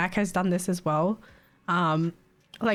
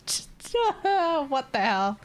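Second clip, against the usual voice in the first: slow, high laughing what the hell; laughing disbelief; 10 semitones higher, much slower than usual. Real, not acted. Vlogger laughing at a caller's rudeness, slow and high.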